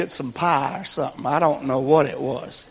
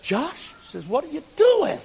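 high, surprised lady's voice; woman turning to the boy in church; church lady asking; 10 semitones higher than usual. Retold or imitated. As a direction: ask like a puzzled older lady. Opal answers the boy.

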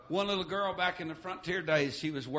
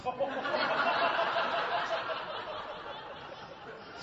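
breathless laugh; 11 semitones higher than usual. Real, not acted. Laugh at son's joke.

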